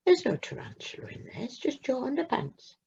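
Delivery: soft reassuring whisper; gentle whisper. A narrator's reading. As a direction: hush him calm with a whisper. Mum gently shows empty drawer.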